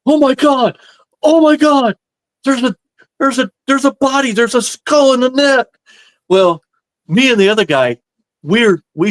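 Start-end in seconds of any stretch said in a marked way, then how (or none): 0.1-5.7 high-pitched imitation of a panicked friend; mock panic. Horrified prank victim finding a skull in the net, mock panic. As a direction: act out freaking out, voice jumping way up.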